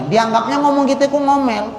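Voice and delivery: ordinary delivery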